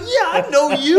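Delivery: very high, loud mock fan yell; hammed-up shout of recognition; imitating starstruck strangers. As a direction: squeal it like an overexcited stranger. Voicing excited passersby who recognize him.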